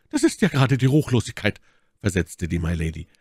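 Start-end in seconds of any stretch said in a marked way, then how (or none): 0.1-1.6 empört. Acted. Milady empört sich über Brandmal.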